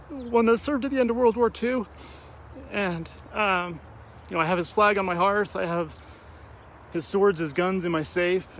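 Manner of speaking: fighting back tears while talking about grandpas; crying. Real, not acted. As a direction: voice breaking high with tears. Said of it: "sorry I got a little emotional there".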